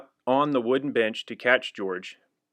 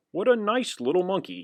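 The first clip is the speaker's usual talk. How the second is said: bright, higher voice for the man; man's thought; 8 semitones higher than usual. A narrator's reading. Reader voicing the man admiring the monkey. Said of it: "he thought".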